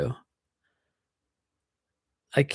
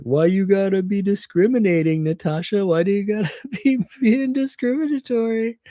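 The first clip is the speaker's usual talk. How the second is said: mock coworker; 5 semitones higher than usual. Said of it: "he's like".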